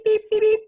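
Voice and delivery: high, loud mock beeps; mock beeping. Host playing a fun-detector nose, mock beeping. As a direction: play a fun-detector going off. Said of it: "it's just going beep".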